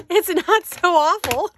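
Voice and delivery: laughing. Real, not acted. Laughing at own craft attempt.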